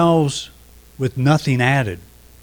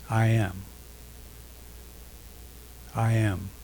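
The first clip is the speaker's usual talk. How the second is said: a soft, quiet repeated I am; said quietly; 10 dB quieter than usual. Shown as a demonstration. Pastor showing how to say I am quietly in meditation. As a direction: let it fall softly, almost to yourself.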